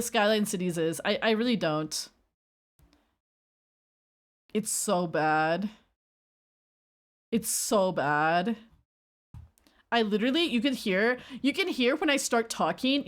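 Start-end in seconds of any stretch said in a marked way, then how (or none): 4.5-8.6 slow groan. Real, not acted. Slow lament over debut.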